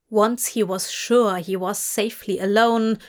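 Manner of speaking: plain reading voice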